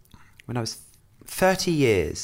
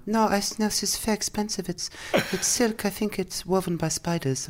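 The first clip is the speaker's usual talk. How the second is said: Austrian shop lady; 11 semitones higher than usual. Retold or imitated. Narrator quoting the Austrian shop owner remembering the top. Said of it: "I said".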